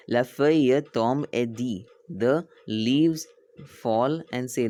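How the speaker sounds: slow teacher reading with spaced words; slow read-aloud. Shown as a demonstration. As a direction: read along slowly for the class. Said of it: "why I'm reading slow".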